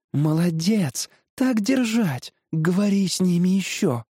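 high, cheering tree voice; encouraging cherry tree. Acted. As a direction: urge your friend on. (подбадривающий высокий голос)

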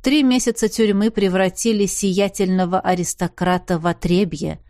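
usual speaking voice

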